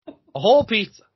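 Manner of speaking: incredulous shout of A whole pizza; outraged emphasis. Real, not acted. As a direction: punch whole in disbelief. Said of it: "outraged repeat".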